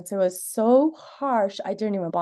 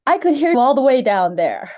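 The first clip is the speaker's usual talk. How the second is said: louder, higher mock complaint from an old man; grumbling elderly passerby; 4 semitones higher, 7 dB louder than usual. Elderly passerby complaining to mother and daughter, loud and sharp. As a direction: imitate the old neighbour scolding them. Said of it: "And he goes".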